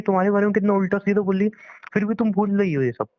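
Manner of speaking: ordinary talk, nothing marked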